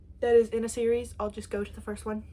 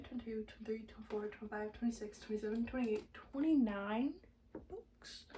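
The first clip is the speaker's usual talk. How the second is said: quiet counting; 7 dB quieter than usual. Real, not acted.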